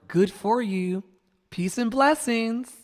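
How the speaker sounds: mock well-wishing, drawn out; empty pleasantry. Imitating people's pleasantries he will sift through, falsely nice. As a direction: imitate a hollow, slow blessing. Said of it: "You know, that kind of energy".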